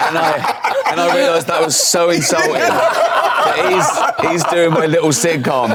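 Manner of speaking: words pushed out through real laughter, high; laughing while talking. Real, not acted. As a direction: talk while still cracking up. Laughing at [name]'s insult.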